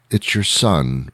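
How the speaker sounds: usual speaking voice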